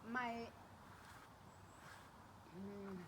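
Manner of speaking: normal talking voice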